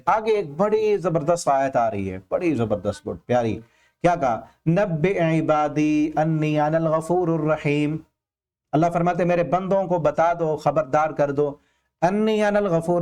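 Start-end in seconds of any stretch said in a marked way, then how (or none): 4.7-8.0 Quran recitation. Acted. Quranic verse recited in sermon.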